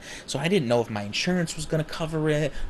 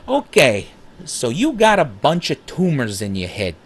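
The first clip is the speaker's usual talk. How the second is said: louder, higher put-on accent for the ER doctor; ER doctor bluntly breaking bad news in a comic put-on accent; 4 semitones higher, 7 dB louder than usual. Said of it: "she didn't have that accent".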